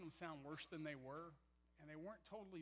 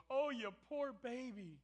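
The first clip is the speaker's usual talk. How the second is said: put-on high, slow, louder baby talk; hammed-up pity for a crybaby; mock coddling; 8 semitones higher, 10 dB louder, slower than usual. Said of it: "He didn't go".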